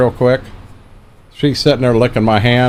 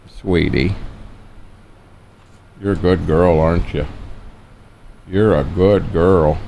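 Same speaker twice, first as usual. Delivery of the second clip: sweet dog talk; 6 semitones lower, 4 dB quieter than usual. Real, not acted. Owner softly praising his dog on his lap, low and tender.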